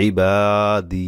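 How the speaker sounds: very slow ইবাদি with a long final ই; ইবাদি ending on a held ই; stretched stop. Shown as a demonstration. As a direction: draw the last দি of ইবাদি out for one alif. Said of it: "এক আলিফ টান দিয়ে সেভাবেই থামতে হবে".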